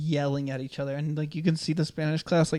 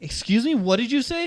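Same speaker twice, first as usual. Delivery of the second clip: high, indignant teacher voice; mock the teacher's outrage, pitched up; affronted teacher; 7 semitones higher, 4 dB louder than usual. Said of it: "and he's like".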